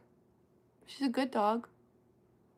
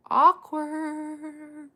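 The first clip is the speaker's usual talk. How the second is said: drawn-out awkward; 6 semitones higher, 5 dB louder than usual. Real, not acted. Reacting to housemate awkward story.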